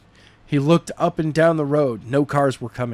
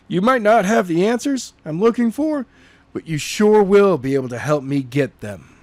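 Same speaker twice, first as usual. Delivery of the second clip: higher, a touch of threat; lightly voiced menacing Skylar; 6 semitones higher than usual. Skylar to the tied-up soldier, lightly menacing.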